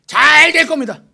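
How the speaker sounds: booming, high-pitched shouted proclamation; hammed-up, very loud priest's pronouncement; mock priestly blessing. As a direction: belt out the blessing like a showy false prophet. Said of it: "그랬더니 뭐라고 할게요".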